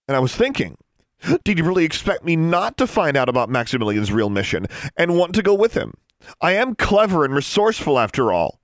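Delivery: high, loud, mounting indignation; indignant tirade. Acted. Daughter railing at her father, indignant. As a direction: throw it in his face.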